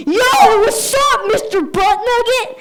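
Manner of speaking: put-on high, loud cheeky kid yell. Kid in the back of class shouting at teacher, high and loud. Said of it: "just goes".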